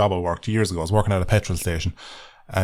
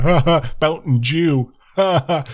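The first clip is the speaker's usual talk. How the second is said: mocking jeer; 5 semitones higher, slower than usual. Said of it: "taking the piss out of me in the comments going".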